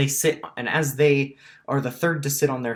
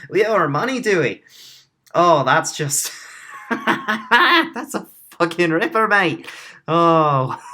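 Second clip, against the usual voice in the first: high, loud pirate captain cracking up; incredulous laughter; 7 semitones higher, 8 dB louder than usual. Acted. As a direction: scoff through big laughs at the very idea.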